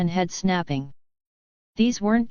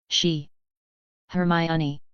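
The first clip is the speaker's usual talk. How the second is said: halting, skipping like a record; broken voice; slower than usual. A narrator's reading. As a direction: stammer it out, voice breaking. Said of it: "Sky's voice was broken".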